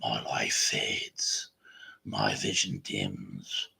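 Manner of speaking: put-on deep, slow whispered old-man narration; old Australian narrator. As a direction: breathe it out like a weary old man telling a legend. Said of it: "thick Australian accent, but actually sounds like an old man".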